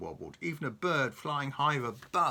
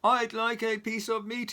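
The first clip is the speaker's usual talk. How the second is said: loud high boom; 10 semitones higher, 6 dB louder than usual. Acted. Mister Noisy booms at Mister Bacon, loud. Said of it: "he boomed".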